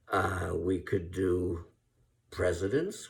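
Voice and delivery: ordinary delivery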